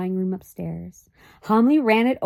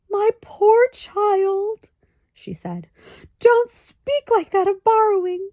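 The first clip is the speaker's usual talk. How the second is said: high, slow, crying mother's voice; high tearful lament, slowly; 12 semitones higher, 6 dB louder, slower than usual. A narrator's reading. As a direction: weep over her poor child. Mother weeping over her child.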